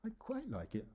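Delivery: QUITE very high and soft, then falling; intonation going up. Shown as a demonstration. Teacher showing how voice changes meaning, high-pitched QUITE. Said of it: "Bit of intonation going up".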